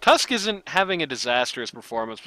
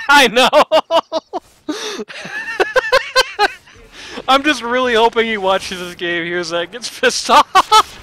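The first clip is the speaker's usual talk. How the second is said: laughing through the words, slow; giggling while talking; 11 semitones higher, 11 dB louder than usual. Real, not acted. Laughing at own impression.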